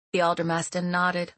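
usual speaking voice

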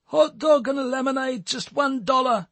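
high pitched vendor call through a megaphone; megaphone sales call. A narrator's reading. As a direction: hawk it into a megaphone, self-conscious.

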